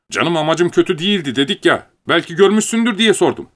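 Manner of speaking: high-pitched defensive whine; exasperated protest. A narrator's reading. Curly protests back in the quarrel. (sitemli, kendini savunan)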